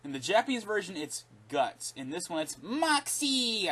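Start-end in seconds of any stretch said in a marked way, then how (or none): 2.6-3.7 higher, slower, scornful "Moxie!"; mild mock of the battle cry; mocking catchphrase. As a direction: sneer at the dub's catchphrase. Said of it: "I hate the way they say it".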